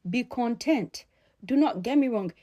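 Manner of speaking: ordinary talk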